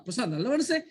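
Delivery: "மனுஷன்" swung upward as a question; questioning tune. Shown as a demonstration. Teacher showing meaning changes by delivery, rising doubtful question. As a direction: query it with a rise on "மனுஷன்". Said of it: "சொல்லும் விதத்தில் தான்".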